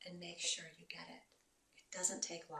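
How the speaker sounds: ordinary talk, nothing marked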